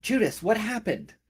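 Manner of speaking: high frantic calling; old lady shouting from off screen. Acted. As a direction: demand answers in alarm. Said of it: "You hear her shouting".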